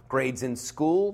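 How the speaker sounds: ordinary delivery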